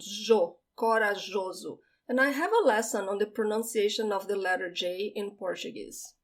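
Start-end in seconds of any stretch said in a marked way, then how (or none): 0.0-1.8 jo and corajoso with a soft zh sound for J; corajoso, J like the s in measure. Shown as a demonstration. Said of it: "The J has this soft sound".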